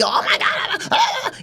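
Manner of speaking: high squealing mock panic; mock freakout. Mocking someone quick to get upset, squealing freakout. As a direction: shriek like someone losing it.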